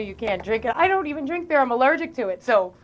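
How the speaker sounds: ordinary delivery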